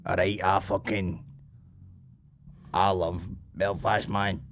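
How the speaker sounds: slow, low put-on voice; imagined soldier fanatic. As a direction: play a halting, low-voiced devotee. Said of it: "are they people who are like".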